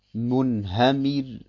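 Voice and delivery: Munhamir langsam, mit hellem R am Ende; helles Ra. Shown as a demonstration. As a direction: lass das R in Munhamir hell ausklingen. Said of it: "dann wird das Ra hell ausgesprochen". (Munhamir gedehnt, End-R hell)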